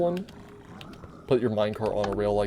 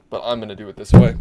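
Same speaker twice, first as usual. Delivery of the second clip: tapping the mic; 13 dB louder than usual. Real, not acted. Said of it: "Sorry for, uh, the tapping of the mic there".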